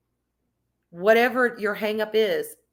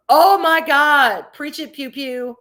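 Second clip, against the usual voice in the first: excited exclamation; 4 semitones higher, 10 dB louder, slower than usual. Real, not acted. Reacting to a viewer's comment.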